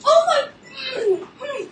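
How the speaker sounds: high, loud shriek of laughter through the words; real giddy laughter; laughing in disbelief. Real, not acted. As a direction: crack up at your own bad luck. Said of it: "I'm laughing".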